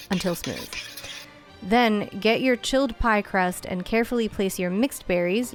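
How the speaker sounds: ordinary talk, nothing marked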